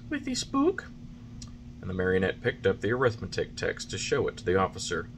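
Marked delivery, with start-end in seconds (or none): 0.1-0.9 put-on high wooden-boy voice, anxious; high puppet boy. A narrator's reading. Pinocchio answering the carabineers, anxious and high.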